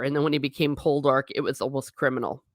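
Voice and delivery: usual speaking voice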